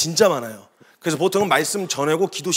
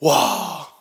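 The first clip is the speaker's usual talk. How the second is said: ordinary talk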